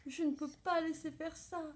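high-pitched distressed little mouse. A narrator's reading. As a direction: fret earnestly in a high small voice. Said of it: "se désole la petite souris". (désolation, petite voix aiguë)

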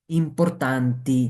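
normal talking voice

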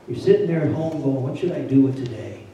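normal talking voice

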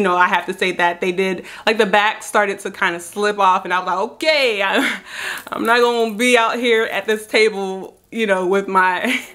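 speaking through teeth appliance; slight lisp from dental cover; talking with veneers in. Real, not acted.

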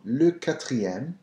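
quatrième said slowly with a French R; le quatrième, slow and careful; French number. Shown as a demonstration. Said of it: "The fourth one".

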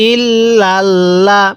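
ইল্লাল্লাহ intoned very slowly, the double l held; teacher modelling ইল্লাল্লাহ for students; slow Quranic recitation. Shown as a demonstration. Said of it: "পড়ুন আমার সাথে".

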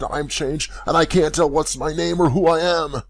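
at wit's end. A narrator's reading. Old man losing his grip on who he is, frantic. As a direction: voice climbing in bewildered alarm. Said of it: "exclaimed he, at his wit's end".

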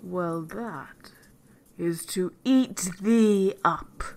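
slow menace swelling loud; crying wicked wolf. A narrator's reading. Wolf turns on the girl, slow and loudly menacing. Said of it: "cried the wolf".

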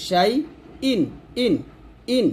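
শাই, pause, then ইন repeated with a clean glottal onset; isolated ইন with a catch at the start, no y; hamza from throat. Shown as a demonstration. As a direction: start each ইন from the top of the throat, set apart by pauses. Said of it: "হলকের শুরু থেকে বলতে হবে".

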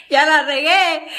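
loud laughing admission of a blooper. Real, not acted. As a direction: blurt it out and laugh.

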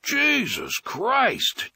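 high, slow drawn-out exasperated yell; high slow yell of disbelief. Acted. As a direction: throw up your hands and yell it out. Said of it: "Trix Postino yelled".

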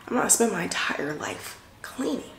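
breathy whisper, no voice; whispered aside. Real, not acted. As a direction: confide it under your breath.

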